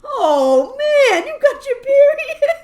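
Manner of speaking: high, loud, drawn-out mock wail; mock sad dad. Dad mourning daughter growing up, slow hammed-up wail. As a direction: wail it slowly as a heartbroken dad. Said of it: "he just seemed so sad".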